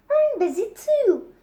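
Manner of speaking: put-on indignant boy shouting back; Bertie insisting. A narrator's reading. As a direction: stamp it out like a cross boy.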